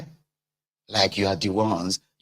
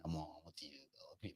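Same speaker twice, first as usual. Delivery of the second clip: muttered curse; 7 semitones lower, 27 dB quieter, faster than usual. Retold or imitated. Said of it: "I'll be like".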